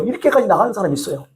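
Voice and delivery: usual speaking voice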